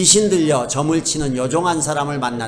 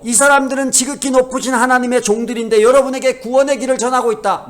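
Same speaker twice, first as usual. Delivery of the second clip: higher, louder proclamation; imitated crying out; 8 semitones higher, 5 dB louder than usual. Possessed woman proclaiming before the people, imitated crying out. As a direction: call it out like the woman in the crowd. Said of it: "사람들 앞에서 막 소리쳐요".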